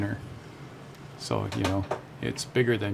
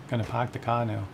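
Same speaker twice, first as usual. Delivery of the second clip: mock Bostonian with dropped R's; Boston accent; 3 dB quieter than usual. Shown as a demonstration. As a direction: put on Boston, no R in "park" or "car". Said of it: "They just dropped the R's".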